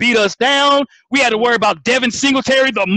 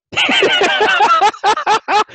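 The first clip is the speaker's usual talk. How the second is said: high laugh; 10 semitones higher than usual. Real, not acted. Big laugh.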